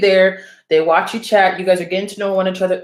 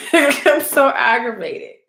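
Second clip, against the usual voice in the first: laughing at herself; 4 semitones higher, slower than usual. Real, not acted.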